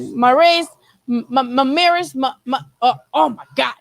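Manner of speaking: mock tongue-tied struggle with "Mamir's"; imitating frustrated stumbling. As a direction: stammer on the M of "Mamir's", then give up. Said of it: "so frustrated with himself".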